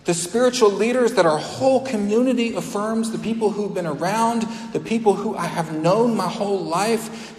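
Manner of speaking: preacher building intensity; rising preaching. Real, not acted. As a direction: press the crowd with each question. Challenging the congregation.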